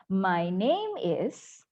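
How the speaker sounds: "My name is" very slow, "name" lifted, "is" dropping; "is" falls, drawn out for students to copy; falling intonation. Shown as a demonstration. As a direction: stretch "name", then step down on "is". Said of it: "turun ya".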